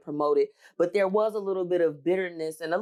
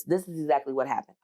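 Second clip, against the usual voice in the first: ordinary talk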